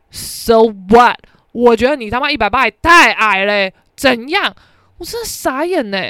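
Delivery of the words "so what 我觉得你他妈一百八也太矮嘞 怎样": loud, scornful retort; loud sassy snap; defiant comeback. Retold or imitated. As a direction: fire it back at him. Imagined comeback to guy.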